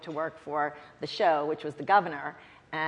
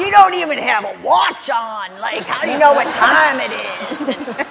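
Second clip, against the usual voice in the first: higher, loud angry tirade building to a shout; furious scolding; 6 semitones higher, 12 dB louder than usual. Retold or imitated. Family friend scolding the late advance staffer, loud angry shout. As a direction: chew her out and threaten to fire her. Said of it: "he says".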